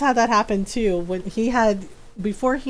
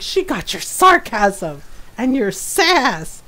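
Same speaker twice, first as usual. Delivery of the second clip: slow, punched "sarcasm" and "sass"; teasing emphasis; slower than usual. Retold or imitated. Ron teasing about inherited sass, slow pointed delivery. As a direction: land hard on "sarcasm" and "sass".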